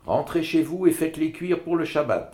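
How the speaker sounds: high, loud, no arguing; final tone. A narrator's reading. Mother tells the woman to cook them, final. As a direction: settle it, no appeal. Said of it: "d'un ton sans appel".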